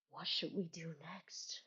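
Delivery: hushed stream whisper; teasing whisper. Real, not acted. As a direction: whisper it like a secret to chat. Streamer whispers a playful question.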